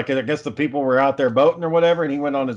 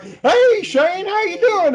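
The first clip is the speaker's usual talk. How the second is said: high, drawn-out goofy holler of greeting; mock an over-eager hello, pitched way up; goofy acquaintance; much slower than usual. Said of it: "that goofy motherfucker comes out of nowhere".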